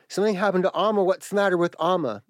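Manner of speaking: high, panicked pleading for news; a scared kid begging to know what happened; frantic child. Retold or imitated. Young boy frantically asking his mother about grandmother, high and panicked. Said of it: "And I am frantic".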